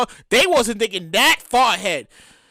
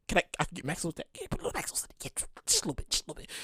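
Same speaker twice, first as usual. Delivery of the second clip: dropping to a near whisper for the extra sauce; hushed order; 7 semitones lower, 15 dB quieter, much faster than usual. Pretend. Customer whispering to the cashier, asking for extra sauce. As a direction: murmur the mac sauce request under your breath.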